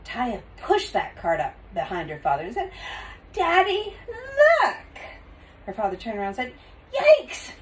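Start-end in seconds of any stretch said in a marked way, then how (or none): 3.3-4.8 put-on high, loud excited little-girl cry; excited child. A narrator's reading. Taya shows her father the loaded cart, excited. 6.9-7.5 high, loud startled yelp. A narrator's reading. Father turns and sees the cart, startled.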